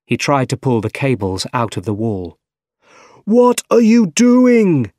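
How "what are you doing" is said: high, loud, drawn-out outraged question; shouting. A narrator's reading. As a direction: shout it in alarm at an intruder. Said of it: "shouted Otto".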